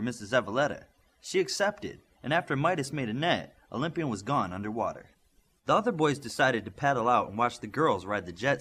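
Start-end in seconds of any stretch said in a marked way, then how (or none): none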